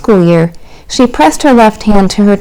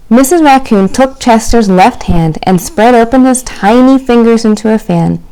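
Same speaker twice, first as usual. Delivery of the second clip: ordinary delivery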